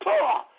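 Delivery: high, sharp "pah"; explosive mock spit; contemptuous spitting sound. Real, not acted. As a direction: spit it out in disgust. Spits at career bureaucrats.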